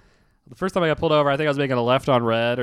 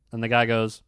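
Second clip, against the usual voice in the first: ordinary talk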